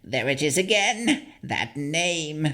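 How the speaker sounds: high hag voice rising on again; a puzzled old crone, lightly voiced. The hag hearing her old name, high rising crone voice.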